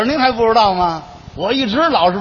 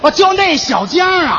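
surprised shout; 7 semitones higher than usual. Acted. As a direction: blurt out the name as it dawns. Sudden realisation, shouted.

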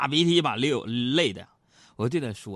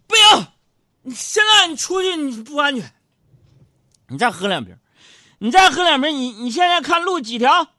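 loud, high, pushy uncle; boozy friend shouting him down; insistent drinking buddy; 12 semitones higher, 9 dB louder than usual. Retold or imitated. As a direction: bellow at him to stay and drink. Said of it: "另一个就不让".

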